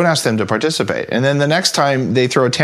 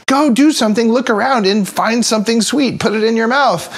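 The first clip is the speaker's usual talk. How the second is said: urgent inner craving; 10 semitones higher than usual. Pretend. The body's sweet craving yelling at restless kids, urgent. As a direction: let the sugar urge bark its orders. Body's urge voiced as a command.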